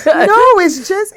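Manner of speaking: laughing, drawn-out denial. Real, not acted. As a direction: laugh it off slowly. Laughing reply to the tease.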